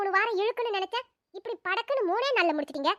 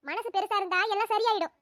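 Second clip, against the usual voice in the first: normal talking voice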